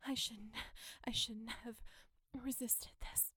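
ordinary talk

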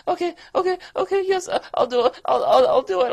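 high, loud desperate gasping okays; panicked pleading. Acted. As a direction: beg frantically, voice up high and loud. Panicked begging to be let go.

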